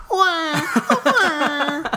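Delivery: high mocking wah wah; mock crying wah. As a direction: whine like a crybaby. Mocking a whiner.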